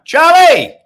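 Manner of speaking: big happy shout to a viewer; greeting a first-time viewer with a loud call. Real, not acted.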